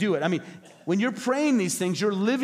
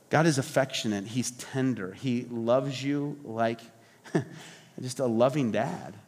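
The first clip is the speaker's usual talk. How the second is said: tender; 7 semitones lower than usual. Real, not acted. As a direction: speak of a loving father gently. Describing God's tender love.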